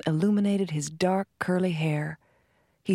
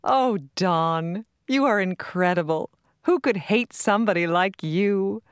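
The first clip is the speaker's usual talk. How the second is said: laughing fondly; 3 semitones higher, 3 dB louder than usual. A narrator's reading. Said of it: "Her laughter pealed".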